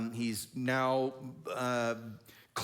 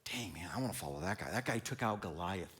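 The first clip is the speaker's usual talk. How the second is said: awed follower; 7 semitones lower, 7 dB quieter than usual. Retold or imitated. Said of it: "you're like".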